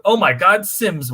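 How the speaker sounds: sudden delighted gasp; surprised. Real, not acted. Surprised a guest joined.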